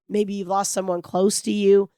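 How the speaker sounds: ordinary talk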